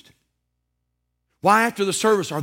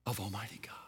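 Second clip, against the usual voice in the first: hushed whisper; 7 semitones lower, 13 dB quieter than usual. Acted. Preacher to church, whispering the climax. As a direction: drop it to a breath.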